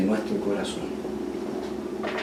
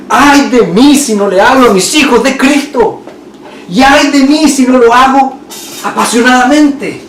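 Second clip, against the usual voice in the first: high, loud, fervent prophet's lament; impassioned cry; 11 semitones higher, 9 dB louder than usual. Acted. Preacher voicing a prophet's fervent lament, high and loud. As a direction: cry it out like a prophet burning inside. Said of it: "Y pensar como los profetas".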